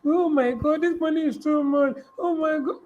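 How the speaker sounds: high-pitched mock dismay at the cost; mock exclaiming. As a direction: exclaim it high, imitating someone aghast. Acts out someone fretting over cost.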